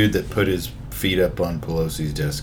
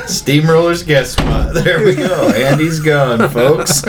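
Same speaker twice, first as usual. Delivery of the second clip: slow, loud, laughing intro; announcer voice; 6 semitones higher, 5 dB louder, slower than usual. Real, not acted. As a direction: hype up the guest. Introduces a guest to listeners.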